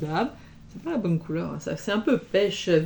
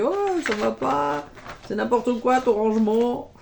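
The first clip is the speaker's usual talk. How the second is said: mock scolding; 4 semitones higher than usual. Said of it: "Laurent, il m'engueulait".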